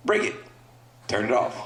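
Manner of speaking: usual speaking voice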